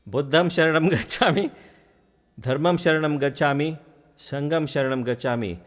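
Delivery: light up with sudden understanding; excited realization. Retold or imitated. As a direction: master thrilled at finally understanding. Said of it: "I said".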